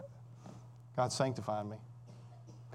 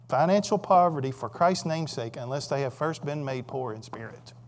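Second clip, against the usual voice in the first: ordinary talk, nothing marked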